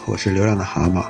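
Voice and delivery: usual speaking voice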